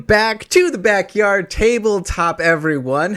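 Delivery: announcer voice. Acted. Podcast intro greeting.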